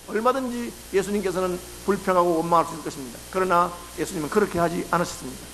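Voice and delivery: usual speaking voice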